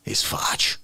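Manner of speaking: soft hushed whisper. Acted. Telling cohost the phone call, hushed whisper. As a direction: drop to a whisper for the punchline.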